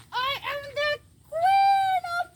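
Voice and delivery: high, slow grand proclamation; mock queen voice. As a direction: proclaim yourself queen, theatrically.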